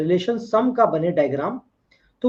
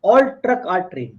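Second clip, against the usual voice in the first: slow statement read; 3 dB louder, much slower than usual. Shown as a demonstration. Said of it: "reading the statement slowly".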